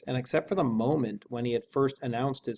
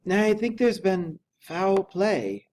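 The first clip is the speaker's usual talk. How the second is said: slow, high, hushed-sounding dread; a hoarse, slow, fearful butler; hoarse whisper of fear; 8 semitones higher, 5 dB louder, much slower than usual. A narrator's reading. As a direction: breathe it out hoarsely, afraid to say it. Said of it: "said Poole hoarsely".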